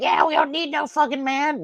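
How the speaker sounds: high parody of a defiant woman; mocking women's voice. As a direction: mimic them sneeringly, way up high.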